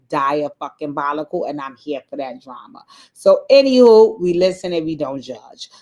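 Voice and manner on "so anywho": loud anywho. Real, not acted. Loud sing-song transition.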